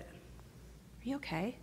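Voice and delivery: hushed, caring check-in; gentle concern. Acted. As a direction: ask softly, almost whispering. Acts caring friend checking in.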